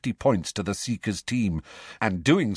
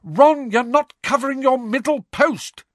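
high, raised shout at the keeper; shouting rebuke; 7 dB louder than usual. Acted. Angelina scolding Ron about his post, shouting. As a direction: yell the correction across the pitch. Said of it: "screamed Angelina".

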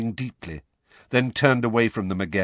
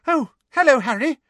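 very high, loud, bright hello; 8 dB louder than usual. Acted. Ginny greets Harry at the door, bright. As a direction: greet him brightly, pitched way up. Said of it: "said Ron's younger sister, Ginny, brightly".

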